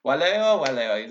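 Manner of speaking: lilting mock-Roman chit-chat; Romans chatting. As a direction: play Romans exchanging pleasantries in Latin. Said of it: "they would say".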